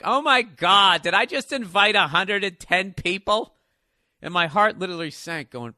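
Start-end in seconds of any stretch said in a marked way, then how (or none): 0.0-3.6 loud, higher panicked gasp; dawning dread, a bit slower; loud high panic over a hundred guests; horrified realization. Retold or imitated. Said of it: "And I was just like".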